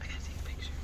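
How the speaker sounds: hushed whisper near animals; wildlife watching whisper. Real, not acted. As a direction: whisper so you don't scare it. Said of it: "Trying to be quiet so we don't scare him".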